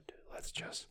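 hushed whisper; soft whisper, drawing a breath. Real, not acted. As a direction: breathe the words out. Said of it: "let's just fucking breathe it in".

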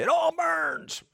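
high, slow put-on voice for Scott; imitating Scott. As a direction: quote Scott in a stretched, high voice. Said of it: "he would say".

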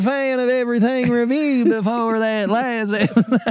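high-pitched Southern complaint; mock Louisiana listener. Louisiana listener renouncing the podcast, mock Southern accent. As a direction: play an offended Louisiana fan, pitched high. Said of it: "four people from Louisiana that listen".

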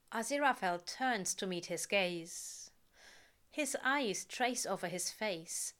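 ordinary delivery, nothing marked

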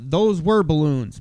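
ordinary talk

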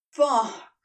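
loud groaned curse; groaning. Acted. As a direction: let it out as a groan. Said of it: "he groaned".

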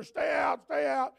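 loud barked "Stay out" with falling ends; booming keep-out command; shouted warning. Acted. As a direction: shout them off like a gatekeeper. Warning voice at temple door.

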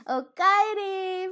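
high, slow, sing-song welcome back; cheery high greeting. Acted. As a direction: stretch it out brightly. Sung-out Japanese greeting.

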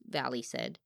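ordinary delivery, nothing marked

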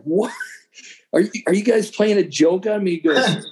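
usual speaking voice

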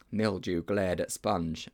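usual speaking voice

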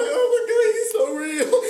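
high, loud starstruck squeal; hammed-up tearful falsetto, laughing through it; mock emotional outburst. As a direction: gush like an overwhelmed kid meeting a hero. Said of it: "and I'm emotional".

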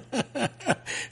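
high chuckle at own joke; amused, bubbling laughter; laughing. Real, not acted. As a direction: run of light, quick laughs.

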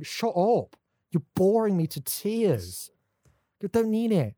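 mock exasperation, drawing out the complaint; exasperated retort. Acted retort to fantasy talk.

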